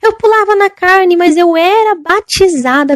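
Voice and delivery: high pleading shout fading down; desperate cry. Retold or imitated. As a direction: call out as a tormented young woman.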